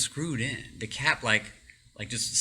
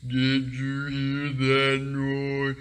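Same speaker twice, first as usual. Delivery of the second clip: slow lip-reading voice; much slower than usual. Retold or imitated. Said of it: "I can read their lips".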